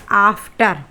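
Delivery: usual speaking voice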